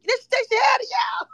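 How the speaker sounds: high, shrieked gloating question; crowing. Real, not acted. Host mocking Nicole to viewers, crowing shriek. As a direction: shriek it at the crowd in glee. Crowing over evicted houseguest.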